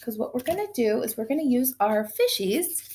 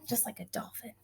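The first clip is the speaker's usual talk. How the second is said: whisper; 14 dB quieter than usual. Real, not acted. Teacher aside to children.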